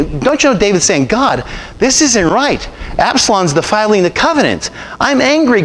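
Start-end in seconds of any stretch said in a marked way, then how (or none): 1.1-5.6 angry prayer. Acted. David crying out to God about Absalom, angry. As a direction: cry out your outrage at God.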